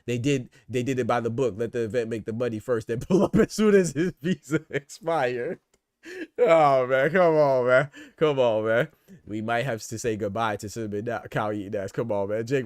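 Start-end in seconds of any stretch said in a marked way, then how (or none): 3.0-5.6 laughing through it. Real, not acted. Laughs mid-joke. 6.4-8.9 exasperated groan. Real, not acted. Exasperated at deportation.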